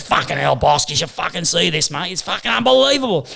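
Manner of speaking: a hint of a hyped-up friend raving; imitating a mate. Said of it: "That's how he talks".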